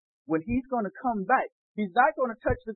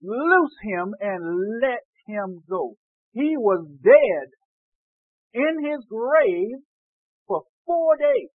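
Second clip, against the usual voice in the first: slow emphatic preaching; 6 dB louder, much slower than usual. Real, not acted. Preacher rousing congregation over the raised man, slow emphatic preaching. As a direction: stretch each phrase and build to a loud peak. Preacher drives the story home.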